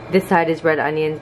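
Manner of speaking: normal talking voice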